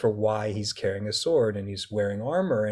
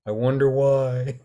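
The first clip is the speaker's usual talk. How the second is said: sarcastic; 2 semitones higher, 4 dB louder than usual. Retold or imitated. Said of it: "You're like".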